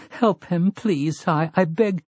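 high, faltering plea; pleading. A narrator's reading. Sister begging boys to help her brother, desperate pleading.

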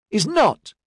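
put-on high-pitched frustrated child's yell. A narrator's reading. Girl chasing her sister for the ball, yelling in frustration. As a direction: protest like a thwarted little sister. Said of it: "she yelled in frustration".

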